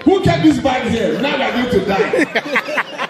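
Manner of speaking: hammed-up high panic with laughter; panicked Nigerian man. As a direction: freak out over the bag blocking the exit. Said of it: "go like".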